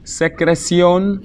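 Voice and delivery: "Secreción" high and drawn out, pitch held up; slow model pronunciation. Shown as a demonstration. Teacher introduces a word to learners, high and slow. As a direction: stretch "Secreción" syllable by syllable, high.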